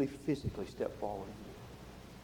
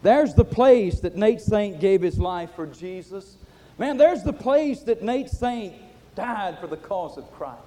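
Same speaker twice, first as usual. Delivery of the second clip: loud, thrilled outburst of discovery; eager young missionary exclaiming, slow and loud; excited; 5 semitones higher, 11 dB louder, slower than usual. Retold or imitated. Young missionary shouting his discovery to the pilot, excited. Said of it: "he so excitedly said".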